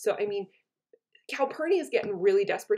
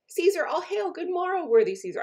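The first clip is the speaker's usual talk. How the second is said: high, bright-sounding hail, much higher and louder; flattering greeting; 10 semitones higher, 5 dB louder than usual. Acted. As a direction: sing out the greeting to butter him up. Decius greeting Caesar flatteringly.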